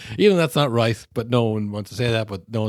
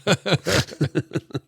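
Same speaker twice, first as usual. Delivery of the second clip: words swallowed by high laughter; laughing; 9 semitones higher, 4 dB louder, much slower than usual. Real, not acted. Podcaster recalling the player swearing at the ref, laughing.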